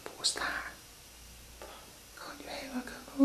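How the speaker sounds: tense hushed whisper. Real, not acted. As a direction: whisper urgently, afraid to be heard. Whispering during ghost hunt.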